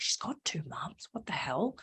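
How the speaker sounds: a hushed, scandalised whisper; shocked whisper. Retold or imitated. Her younger self gasping at two mums, whispered.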